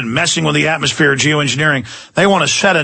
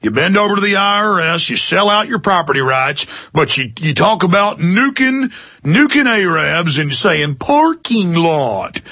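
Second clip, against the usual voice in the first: put-on Southern good old boy; 3 semitones higher than usual. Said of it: "I hear the good old boys".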